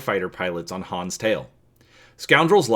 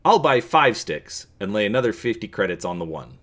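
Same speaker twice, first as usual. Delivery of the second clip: ordinary delivery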